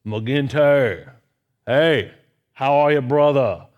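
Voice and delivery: loud, drawn-out Cajun professor impression; Hooley Goddard's Cajun accent. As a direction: greet a student with a big, slow Cajun accent. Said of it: "He would talk like this".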